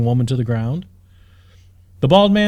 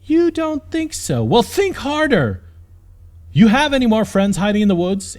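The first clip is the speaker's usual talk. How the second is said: incredulous interrogation; 7 semitones higher than usual. Acted. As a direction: squeeze him for answers. Woman interrogates scared giant.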